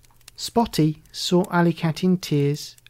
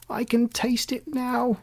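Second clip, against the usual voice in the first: excited; 8 semitones higher than usual. A narrator's reading.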